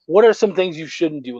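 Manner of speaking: ordinary talk, nothing marked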